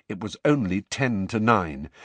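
plain reading voice

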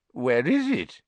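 lightly voiced reedy official. A narrator's reading. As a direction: demand it in a thin, reedy voice. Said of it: "said the reedy voice".

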